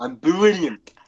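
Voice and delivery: loud, high boast; David Tennant. Acted. Trying out David Tennant, loud exuberant impression. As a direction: burst out, punching the second word. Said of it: "David Tennant we need to try out".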